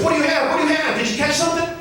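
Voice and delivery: high-pitched, eager rapid-fire questions; excited questioning. Retold or imitated. Fishing buddy running over to see the catch, excited. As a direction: mimic a friend running over, voice way up. Sue runs over after his scream.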